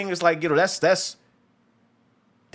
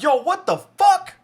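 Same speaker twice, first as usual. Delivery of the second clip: high, drawn-out shocked yo; stunned at the sudden kill, slow and high; shocked disbelief; 13 semitones higher, 5 dB louder, slower than usual. Retold or imitated. Viewer reacting to the throat cut, shocked and high. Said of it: "And you're like".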